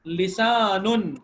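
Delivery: لسان stretched very slowly into lisanun; slow drawn word. Shown as a demonstration. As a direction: drag out لسان for students to copy. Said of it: "انہوں نے پہلے ہی دے دیا تھا".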